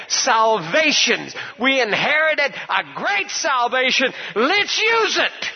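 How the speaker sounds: high, impassioned revival preaching; fired-up preacher crying out each phrase; full-force preaching. Real, not acted. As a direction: preach it high, building to a shouted finish.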